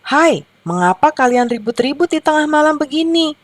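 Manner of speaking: high-pitched storybook challenge; mild scolding question. A narrator's reading. Owl asks noisy hens about midnight racket, mildly scolding.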